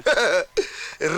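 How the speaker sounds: short dismissive chuckle; mock-confident laugh; scoffing laugh. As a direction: laugh off the warning. Scoffs at the risk warning.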